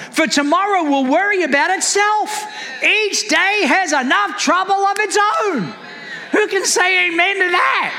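high-pitched, driving sermon delivery; emphatic, exhorting preacher; impassioned preaching. Real, not acted. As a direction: preach it with rising urgency to the congregation. Preaches at full force.

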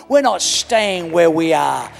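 loud, high, fervent preaching; full-force preaching. Real, not acted.

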